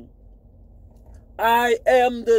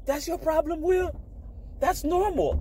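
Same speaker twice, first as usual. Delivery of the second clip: high, soft mocking voice; a squeaky imagined voice needling him; mocking questioner; 6 semitones higher, 6 dB quieter than usual. Imagined voice teasing Will, high and soft.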